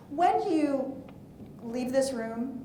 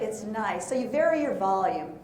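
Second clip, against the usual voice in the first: ordinary talk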